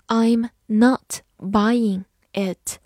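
slow, separated words for learners; very slow teaching model. Shown as a demonstration. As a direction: leave a clear gap after every word. Teacher models sentence word by word.

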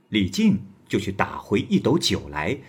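ordinary delivery, nothing marked

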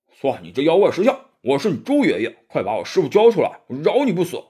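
loud boastful yelling in a rough voice; blustering shout. A narrator's reading. Pigsy yelling at the demon to hand over master, loud and boastful. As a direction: bellow threats like a swaggering brute. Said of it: "猪八戒嚷道".

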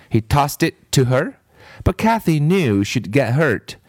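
plain reading voice

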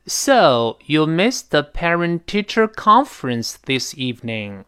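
slow line climbing to "con" in "conference"; tune peak on con. Shown as a demonstration. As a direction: let "con" crest, then fall away on "this evening". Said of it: "读到了一个音调上一个高潮". ("conference"的"con"是音调高潮)

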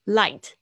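Light said loud, the final t barely touched; soft final T. Shown as a demonstration. Teacher repeating the word for students, loud with a soft t. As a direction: clip "Light" so its t stays very light. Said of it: "那个T发得很轻".

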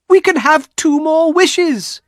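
loud, very high, drawn-out elation; excited cry. A narrator's reading. Wife began to laugh and cry over wishes, excited cry. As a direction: cry it out in wild hope. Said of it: "she cried".

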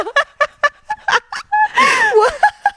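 a real helpless laughing fit; squealing burst of laughter; big laugh. Real, not acted.

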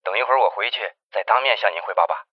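quiet, hurried report over a phone line; hushed phone call. Acted. Investigator Jiang reports news to the chief by phone, hushed.

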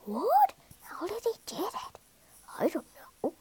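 hushed, mostly high astonishment; soft, baffled murmurs of disbelief; astonished. A narrator's reading. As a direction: whisper in amazement among the crowd. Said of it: "The other animals were astonished".

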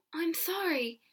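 quick apology. A narrator's reading. Accused girl to the villagers, apologising quickly. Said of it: "Alison said quickly".

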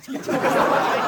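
high, breathless giggle; real laugh at the joke; amused laugh. Real, not acted. Narrator laughs at the boy's money trick.

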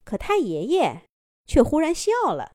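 usual speaking voice

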